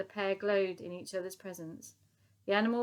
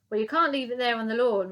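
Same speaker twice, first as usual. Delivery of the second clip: louder barked roar; 4 semitones higher, 9 dB louder than usual. A narrator's reading. Sergeant major at the girls about the poop, roaring. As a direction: roar it across the lawn. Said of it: "roared the sergeant major".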